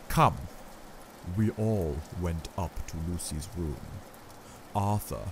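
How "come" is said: loud, high, firm Come; firm command. Acted. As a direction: snap it out as an order. Van Helsing urges.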